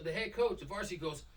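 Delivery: ordinary talk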